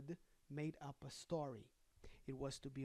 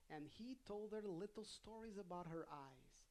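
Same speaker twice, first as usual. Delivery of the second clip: ordinary delivery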